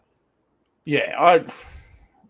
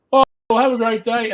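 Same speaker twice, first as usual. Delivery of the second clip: fake cheery seller; 9 semitones higher, 6 dB louder than usual. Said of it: "And then he goes".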